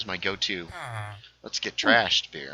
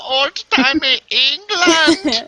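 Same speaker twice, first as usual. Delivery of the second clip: put-on much higher, louder, very slow German accent; mock German accent; 12 dB louder, much slower than usual. Repeating his friend's line in a loud, slow mock German accent. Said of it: "Now he was in a German voice".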